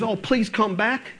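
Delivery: hammed-up pleading apology; mock begging. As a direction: play a needy leader begging the crowd to come back. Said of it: "begging and pleading with the others".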